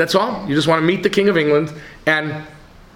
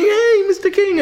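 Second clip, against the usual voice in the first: put-on high, bright child's call much higher; boy calling the king. Small boy calling out to the passing king. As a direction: call out like an eager little boy.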